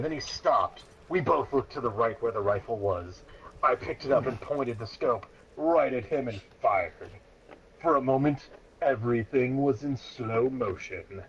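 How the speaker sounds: ordinary delivery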